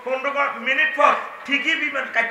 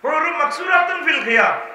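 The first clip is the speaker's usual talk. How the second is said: loud preaching; 7 semitones higher, 4 dB louder than usual. Real, not acted. Preacher quoting scripture loudly.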